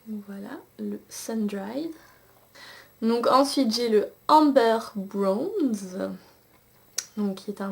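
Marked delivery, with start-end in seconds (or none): 0.1-1.9 whisper. Real, not acted. Swatching shades, hushed aside.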